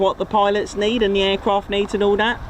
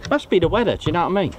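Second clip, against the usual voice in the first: ordinary talk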